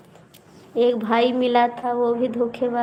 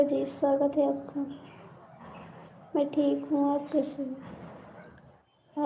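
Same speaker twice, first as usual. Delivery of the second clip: softer, very slow, almost breathed; hushed greeting; 2 semitones higher, 10 dB quieter, slower than usual. Real, not acted. Greeting a viewer on the stream, very softly and slowly. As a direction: ask it drawn out and barely audible.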